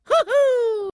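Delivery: high falsetto hoo-hoo yelp; cartoon plumber's falsetto whoop; Mario whoop. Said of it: "Mario cartoon whoop".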